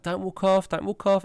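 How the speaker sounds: high-pitched mum calling out; imitated worried mum. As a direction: mimic a mother warning her toddler. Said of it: "you can hear the mum in the background".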